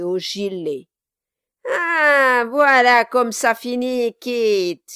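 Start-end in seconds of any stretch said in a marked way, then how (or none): 1.7-4.8 loud high gloat; gloating dwarf. Acted. Quilp gloats at Kit, loud. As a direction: rub it in. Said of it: "s'cria-t-il".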